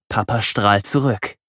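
ordinary delivery, nothing marked

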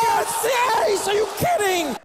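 high, loud stunned yell; excited shout. Real, not acted. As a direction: roar it at the ace. Caster shouting at ace.

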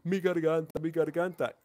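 whiny, drawn-out mock complaint about a sore throat; mock whining. Mocking disobedient kids who whine about their throat, hammed-up whimper. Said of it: "andan llorando que".

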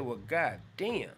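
low, slow, drawn-out god damn; stunned resignation. Retold or imitated. Host answering the drummer, slow and low. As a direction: let it sink in, slow and low. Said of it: "I said".

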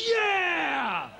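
loud, high cheering yeah; crowd cheer. Retold or imitated.

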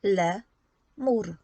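Le and mur said as two separate beats; splitting into syllables. Shown as a demonstration. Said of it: "podziel słowo lemur na sylaby". (podział na sylaby, Le - mur)

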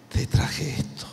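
soft unvoiced whisper. Booz whispering his gift to Ruth. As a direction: breathe it out secretly.

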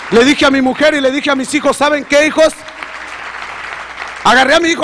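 high, loud, fervent testimony; rising, loud pulpit voice; preaching at full force. Acted. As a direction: preach it out to the congregation.

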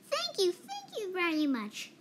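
high, happy bubbling thanks; bubbly and playful. A narrator's reading. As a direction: gush with delight. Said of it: "bubbled playfully".

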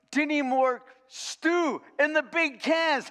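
high, slow, disbelieving emphasis; incredulous. Retold or imitated. Coach telling the crowd, stunned by the cans. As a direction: stretch it out in disbelief.

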